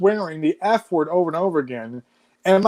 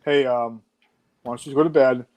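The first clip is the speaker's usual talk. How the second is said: imitating dad, pitched lower, easy and calm; 6 semitones lower than usual. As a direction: talk him down gently as the father. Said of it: "And my dad just goes".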